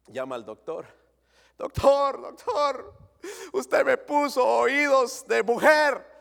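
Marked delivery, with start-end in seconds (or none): 1.6-6.1 high, slow, wailing patient voice; panicked patient. Retold or imitated. Man in the joke complaining to his doctor, high wailing voice. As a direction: whine it to the doctor, higher.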